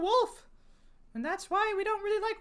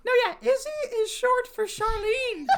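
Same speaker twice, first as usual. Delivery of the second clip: laughing while talking; 6 semitones higher, 5 dB louder than usual. Real, not acted. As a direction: laugh through the words. Both crack up at nickname.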